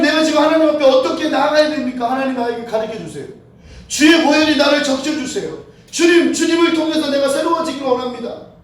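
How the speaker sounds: loud, high pleading prayer; impassioned plea. Acted. Praying to the Lord over a child gone wrong, impassioned plea. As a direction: cry out to God as in desperate prayer.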